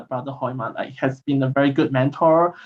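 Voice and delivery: ordinary talk, nothing marked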